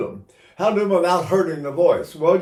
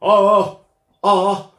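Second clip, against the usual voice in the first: lighter accented ahs, only slightly louder; smaller accents; 5 semitones higher, 9 dB louder, much slower than usual. Shown as a demonstration. Singer demonstrating small accents to students, lighter punched ahs. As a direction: give small accents, not big. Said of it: "not all accents are big ones".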